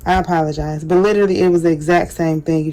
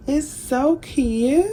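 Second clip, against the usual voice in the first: much higher, slow gushing coo; slow high admiring coo; gushing cute; 7 semitones higher, 3 dB quieter, much slower than usual. Real, not acted. Admiring finished topper.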